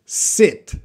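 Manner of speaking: short lax i in "Sit", mouth barely moving. Shown as a demonstration. Teacher modelling "Sit" for the class to repeat. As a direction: keep the i in "Sit" short and relaxed. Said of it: "you should be repeating after me".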